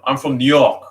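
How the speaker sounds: a light New York accent impression; older New Yorker accent, loud; Bernie Sanders. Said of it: "like Bernie Sanders, if you heard him".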